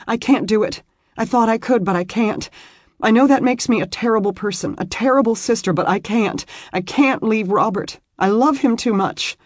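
put-on a torn young wife pouring out her guilt; young woman, distressed. Beth refusing to go home, high and pleading.

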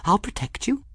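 very high, small character voice; tiny high voice comforting a crystal; whispering to crystal. A narrator's reading. Folly promises her crystal protection, in a tiny high whisper-like voice. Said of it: "she whispered to her little crystal".

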